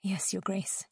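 soft, subdued reply; humbled, hushed obedience; meek submission. A narrator's reading. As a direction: answer quietly with head hung. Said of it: "she hung her head".

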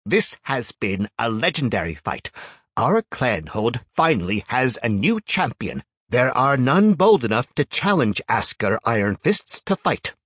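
loud, grand arbiter voice; proud announcer. A narrator's reading. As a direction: proclaim it to the whole clan. Arbiter addresses the cheering cave.

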